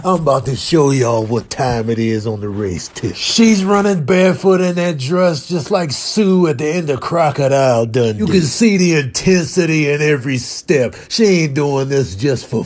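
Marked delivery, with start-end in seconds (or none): none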